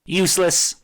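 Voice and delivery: high, scornful single word; a gargoyle spitting out contempt; decrying. A narrator's reading. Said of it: "the gargoyle decried".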